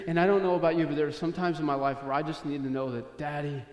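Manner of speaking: ordinary talk, nothing marked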